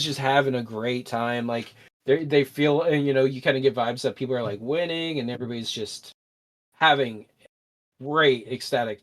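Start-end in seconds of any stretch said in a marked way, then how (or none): none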